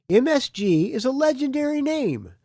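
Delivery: high, grand announcer read; hyped-up press blurb reading; promo read. Acted. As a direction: proclaim it like album hype. Starts reading album promo text.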